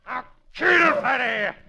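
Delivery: loud, high command; a villain screaming at his gorilla; furious shout. Acted. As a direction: roar the order at the beast.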